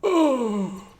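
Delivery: high, loud hammed-up whine, slow at first; mock tired whine. Gamer complaining about too little caffeine, mock whining. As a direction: moan about being tired like a cartoon.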